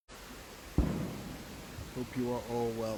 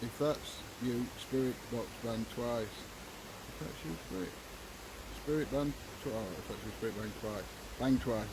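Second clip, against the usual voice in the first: hushed; 7 dB quieter than usual. Real, not acted. Calling to spirit, hushed.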